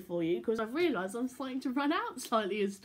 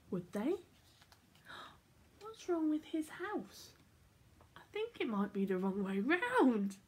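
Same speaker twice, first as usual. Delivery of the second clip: put-on lively read-aloud for children; animated storybook reading; story narration; 4 semitones higher than usual. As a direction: tell it like a bedtime picture book. Reads picture book aloud.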